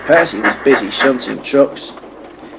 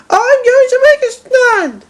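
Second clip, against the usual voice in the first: high, loud crying-out shout; Percy shouting; 5 dB louder than usual. A narrator's reading. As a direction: yell it in a high strained voice. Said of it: "he shouted".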